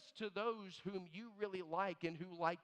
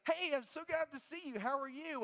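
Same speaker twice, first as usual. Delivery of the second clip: high, bright hello; cheerful greeting; 7 semitones higher than usual. Retold or imitated. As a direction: greet them with gusto. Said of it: "So I say".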